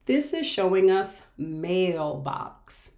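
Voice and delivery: ordinary delivery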